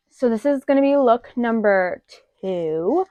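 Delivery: ordinary talk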